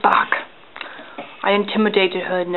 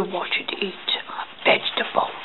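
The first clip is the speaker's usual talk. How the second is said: hushed documentary whisper; 3 semitones lower, 9 dB quieter than usual. Acted. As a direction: whisper like watching wild animals. Nature documentary hush.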